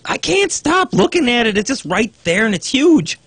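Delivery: amused exclamation. Real, not acted. Staring at sumo buttocks.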